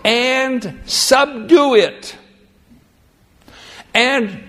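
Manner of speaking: high, slow, weighty reading; slow emphatic scripture. Shown as a demonstration. Preacher to congregation, slow weighty stress. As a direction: lean hard on each word. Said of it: "Listen to this".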